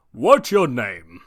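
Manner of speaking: slow, loud suspicious question; probing the boy; skeptical All Might. Acted. All Might eyeing Midoriya, slow suspicious question.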